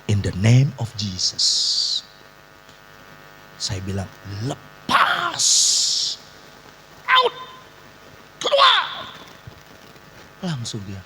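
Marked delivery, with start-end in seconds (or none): 0.1-2.0 slow, low, drawn-out invocation; deliberate, low-pitched proclamation; solemn invocation. Retold or imitated. Retells exorcism command. 4.4-9.2 explosive high-pitched shout; re-enacted casting-out yell; shouted exorcism command.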